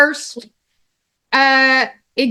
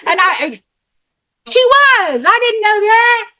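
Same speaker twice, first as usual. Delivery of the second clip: excited gush; 8 semitones higher than usual. Retold or imitated. Friend marveling over the phone at her story, high and thrilled. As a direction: gush like she's witnessing history. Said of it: "And she says".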